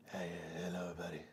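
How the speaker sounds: whispered greeting, soft and airy; breathy hushed hello; stage whisper. Shown as a demonstration. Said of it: "Just turn into a whisper scream".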